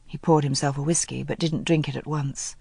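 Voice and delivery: ordinary talk, nothing marked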